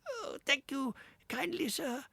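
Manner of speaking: high, feeble washerwoman voice. Acted. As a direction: Toad faking a frail old woman. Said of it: "said Toad in a feeble voice".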